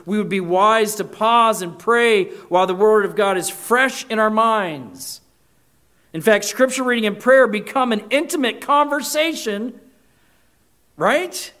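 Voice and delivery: rising preacher's fervor; full-force preaching. Real, not acted. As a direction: press the point home to the congregation.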